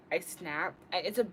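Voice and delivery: ordinary talk, nothing marked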